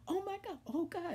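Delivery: high-pitched retold panic; mom freaking out. Mom looking into her daughter's mouth, freaking out. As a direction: imitate a mother starting to panic. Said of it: "she started freaking out".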